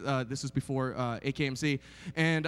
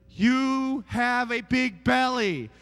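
put-on slow, childlike voice, a little louder; child's blunt remark; 4 semitones higher, 5 dB louder, much slower than usual. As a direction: mimic a small boy blurting it out slowly.

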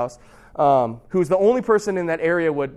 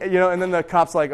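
normal talking voice

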